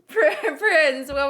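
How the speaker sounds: laughing. Real, not acted. Laughing while calling the next player.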